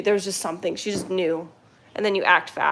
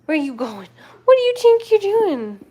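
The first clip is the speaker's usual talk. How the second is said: scolding a pet; 11 semitones higher, 5 dB louder than usual. Real, not acted. Catching a pet misbehaving.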